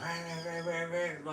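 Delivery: high-pitched repeated bird squawk; rapid high rah-rah squawking; worked-up bird imitation. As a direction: imitate a bird working himself up. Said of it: "like, working himself up".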